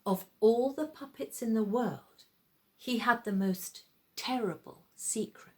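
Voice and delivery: plain reading voice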